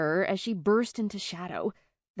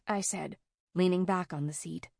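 usual speaking voice

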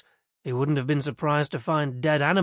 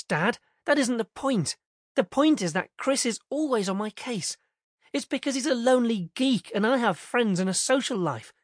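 put-on higher, whiny teen daughter arguing; exasperated teenage girl; 6 semitones higher than usual. A narrator's reading. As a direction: complain like a fed-up teenager. Narrator voices Jen, exasperated teen.

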